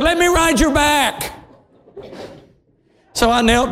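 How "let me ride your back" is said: put-on high child's voice, wheedling; pleading little girl. Tired girl asking adult for a ride, pleading. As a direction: ask high up like a small child. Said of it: "So she said".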